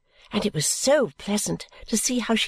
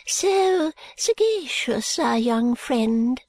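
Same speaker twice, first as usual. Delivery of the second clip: high, slow, hushed and mysterious; 3 semitones higher, much slower than usual. Acted. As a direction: speak as if sharing a secret. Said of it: "in her mysterious way".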